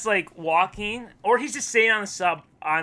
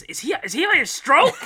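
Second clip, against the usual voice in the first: high, louder incredulous question; mock alarmed onlooker; 10 semitones higher, 6 dB louder than usual. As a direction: react with hammed-up bewilderment. Acting baffled onlookers below.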